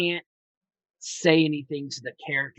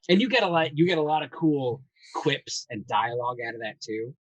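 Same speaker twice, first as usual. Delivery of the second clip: ordinary delivery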